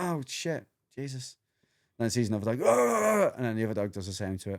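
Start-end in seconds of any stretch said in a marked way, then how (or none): 0.0-1.4 higher, softened startled dog voice; mock alarm. Voicing the dominated dog's alarm. 2.7-3.3 short high, loud mock bark; imitated dog barking. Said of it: "it's barking is making".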